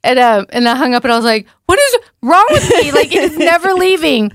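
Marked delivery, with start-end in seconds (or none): 1.7-4.3 panicked. Retold or imitated. Panicking after hanging up.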